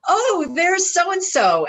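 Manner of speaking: delighted greeting. Retold or imitated. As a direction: coo it like you've spotted a favourite dog. Her going.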